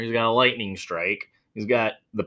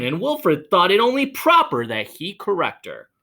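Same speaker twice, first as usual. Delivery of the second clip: plain reading voice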